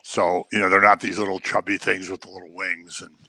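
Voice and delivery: ordinary talk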